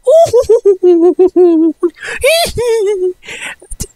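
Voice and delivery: high shrieking giggle; imitated squealing laugh. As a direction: mimic a squealing fit of laughter. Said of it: "will go up to you and go".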